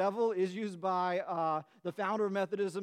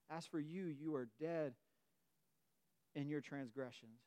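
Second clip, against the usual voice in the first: much softer and lower, almost breathed; a quiet, intimate verdict; hushed reading; 7 semitones lower, 12 dB quieter than usual. Shown as a demonstration. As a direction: let it sink in, very softly. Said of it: "singular, individual".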